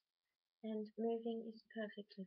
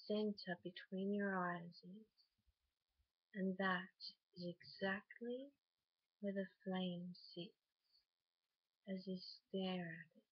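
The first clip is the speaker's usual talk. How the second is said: guided meditation. Acted. Guiding a listener to picture a flame before sleep, calm meditation voice. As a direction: lead them unhurriedly into rest, phrase by phrase.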